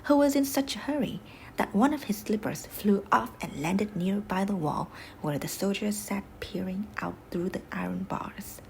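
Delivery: ordinary delivery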